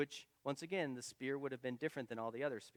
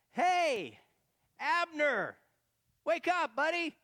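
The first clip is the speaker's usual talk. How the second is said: loud, high call across the distance; high, ringing hail across a valley; 13 semitones higher, 9 dB louder, much slower than usual. Acted. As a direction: shout to wake a sleeping guard. David calls across to enemy camp.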